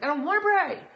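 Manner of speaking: high, loud mock protest; mock refusal. Upset believer balking at prayer, loud high mock protest. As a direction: play up an upset refusal to pray.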